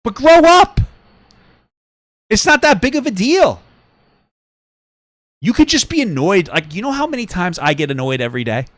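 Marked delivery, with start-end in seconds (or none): 0.0-6.5 loud high yell. Real, not acted. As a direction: still shouting it down at her. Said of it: "Sorry, I'm yelling".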